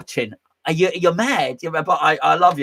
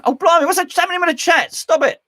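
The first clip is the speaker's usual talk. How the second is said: surprised; 13 semitones higher, 3 dB louder than usual. Real, not acted. Reacting to chat.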